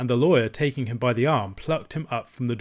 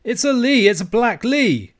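outraged high Scots outcry; cried out; 11 semitones higher, 7 dB louder than usual. A narrator's reading. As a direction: cry out as the outraged old uncle. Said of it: "cried my uncle".

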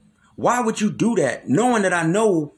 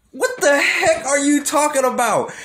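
high, loud mock bewilderment; incredulous, raised-pitch outburst; retold disbelief; 10 semitones higher, 3 dB louder than usual. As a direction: blurt it high in exaggerated disbelief. Retold shocked reaction to tattoo artist.

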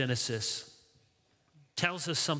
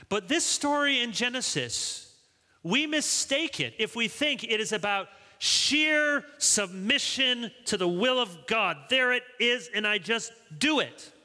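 high, impassioned sermon delivery; emphatic preaching; 8 semitones higher, 3 dB louder than usual. Real, not acted. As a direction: drive the point home to the congregation. Preaches with full force.

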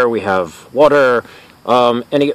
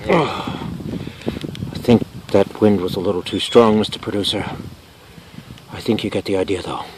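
understate it after getting knocked about; shaken understatement; 3 semitones lower, 4 dB quieter than usual. Acted. Host to producer after the wind blast, shaken understatement.

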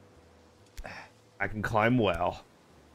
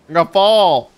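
high, loud panicked cry; panicked yell; 7 semitones higher, 14 dB louder than usual. Real, not acted. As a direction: yell it as you slip. About to fall in game.

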